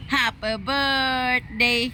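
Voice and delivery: very slow, soft, high sing-song; slow demo. Shown as a demonstration. Owner drawing out each word for the parrot. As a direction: spell it out slowly so the parrot copies. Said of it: "slow demo for parrot".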